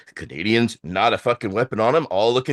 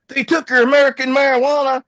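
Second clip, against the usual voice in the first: high, loud and fast, mock outrage; shocked gasp; 12 semitones higher, 6 dB louder, faster than usual. Border agent outraged the weed was seized, high loud shock. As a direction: shoot it out like the scandal of the century.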